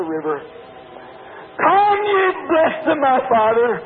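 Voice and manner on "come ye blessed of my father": high, loud proclaiming call; a raised, higher, louder call in Christ's voice; the Shepherd calling. Acted. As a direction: ring it out as the Shepherd summoning the blessed.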